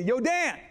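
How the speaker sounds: Yodan said with relish, stretched out; savoring the name. Shown as a demonstration. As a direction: say it like you love the name. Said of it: "I wanna say".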